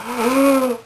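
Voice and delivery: high, drawn-out mock gasp; exaggerated intake of shock, softly voiced; imitated horrified gasp. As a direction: gasp like a jaw-dropped janitor. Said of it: "'cause he's horrified".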